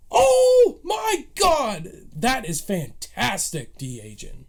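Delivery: stunned fan squeal, stretched out; awed gasp. Retold or imitated. Fan reliving the Luke reveal, awed squeal.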